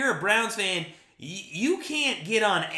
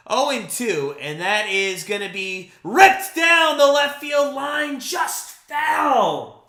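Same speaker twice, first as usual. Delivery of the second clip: higher, louder call that peaks then drops on the foul; excited play-by-play; 6 semitones higher, 6 dB louder, slower than usual. Acted. Fan streamer calls a near double for his chat, excited then let down. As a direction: ride the swing up, then deflate.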